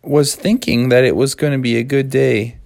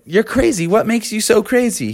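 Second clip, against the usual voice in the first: higher-pitched baffled question; alarmed frogs; 7 semitones higher than usual. Acted. Froggy and dad question the crazy snowman, higher and baffled. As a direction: ask with mild alarm. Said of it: "And they said".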